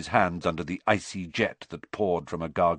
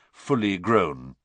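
ordinary delivery

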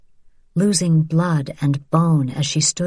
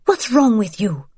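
a sharp, mildly raised reproach; harsh; 6 semitones higher than usual. A narrator's reading. Vianne rebuking Isabel for slapping Sophie, lightly harsh. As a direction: scold her, understated harshness. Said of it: "Vianne said harshly".

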